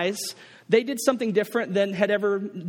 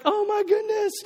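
moved gasp; 12 semitones higher than usual. Retold or imitated. Crowd moved by the scene.

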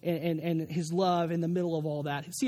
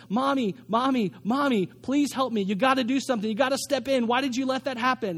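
put-on higher, urgent child begging Mom for help; imitated pained pleading; 5 semitones higher, 3 dB louder than usual. Hurt daughter begging her mother to step in, pleading. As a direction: plead insistently, a retold cry without full screaming. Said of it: "the screams come from this place of pain".

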